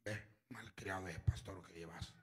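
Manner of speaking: a breathless whispered rebuke; mock scolding whisper; whispered quote. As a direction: hiss the wife's scolding in a whisper. Wife's hostile quote, whispered.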